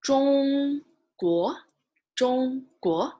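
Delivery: 中国 said with 中 high and level, 国 rising; Mandarin Zhongguo. Shown as a demonstration. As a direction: read 中国 clearly for the class. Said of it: "without the pinyin".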